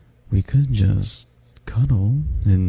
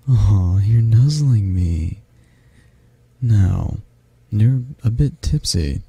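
ordinary delivery

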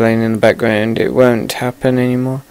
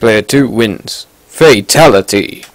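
booming fighting game announcer. Acted.

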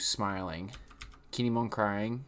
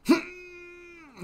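a sudden high, strained falsetto laugh; shrill cartoon cackle; imitated laugh; 4 dB louder than usual. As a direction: squeak out a laugh in Zoro's place. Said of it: "Zoro's just like this".

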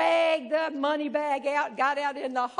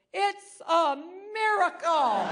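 slow, higher, grandly proclaimed; grand flourish; 5 semitones higher, 3 dB louder, slower than usual. Retold or imitated. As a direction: announce it like a revelation. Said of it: "with great flourish says".

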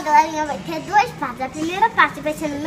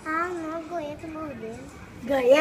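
normal talking voice

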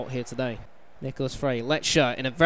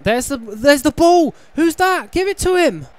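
high, loud urgent calls; urgent shouting; 11 semitones higher, 10 dB louder than usual. Real, not acted. Gamer shouting at players on screen, urgent. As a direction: urge the players, much higher and louder.

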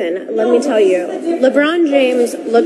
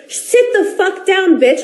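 higher mock-tough put-down; imitating LeBron's insult; 6 semitones higher, slower than usual. Wife voicing LeBron telling her to sit down, mock-tough higher bark. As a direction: toss the insult back as his line.

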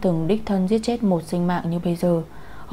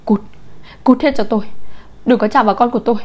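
loud, higher furious dismissal; distraught mother yelling; shouted order; 4 semitones higher, 4 dB louder, faster than usual. A narrator's reading. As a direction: drive them away with a shout. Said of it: "quát cô ấy".

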